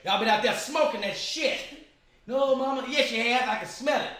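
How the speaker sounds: loud, high-pitched telling-off; scolding grandmother. Retold or imitated. Grandmother accuses child of being out smoking, loud mock scolding. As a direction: imitate a grandmother laying into a child. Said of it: "my grandmother, was like".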